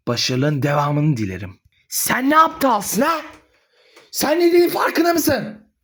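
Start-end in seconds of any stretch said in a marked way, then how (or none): 1.9-5.7 shocked scolding. Acted. Mother scolds son in shock.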